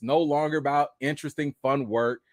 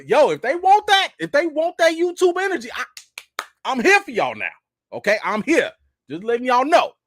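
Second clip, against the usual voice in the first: loud, high hype call; hyped call-out; 9 semitones higher, 5 dB louder than usual. Pretend. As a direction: shout it out like a hype man. Calling out to Marvel.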